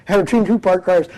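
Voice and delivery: normal talking voice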